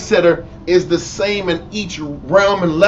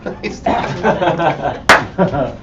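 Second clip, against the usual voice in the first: laughing through the line. Retold or imitated. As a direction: crack up while saying it. Mock husband, laughing.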